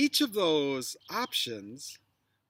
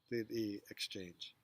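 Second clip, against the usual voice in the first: usual speaking voice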